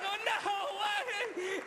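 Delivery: high-pitched mock horror; imitated cry of dismay; hammed-up crowd panic. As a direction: wail like a disgusted, panicking crowd. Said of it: "and they're like".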